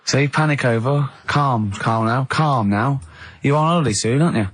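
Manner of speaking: usual speaking voice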